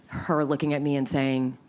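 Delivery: ordinary talk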